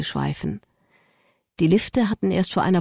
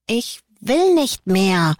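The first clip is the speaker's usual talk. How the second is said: put-on slow sulky little boy voice, higher; grumbling child; 4 semitones higher, 4 dB louder, slower than usual. A narrator's reading. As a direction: grumble it like a tired kid. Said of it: "murrte Liam".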